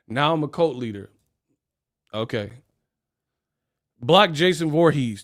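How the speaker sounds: ordinary talk, nothing marked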